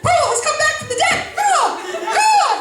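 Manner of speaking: high laughing crow caws; hammed-up bird noises; bird calls. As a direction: caw like a seagull, cracking up. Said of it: "after making numerous bird calls".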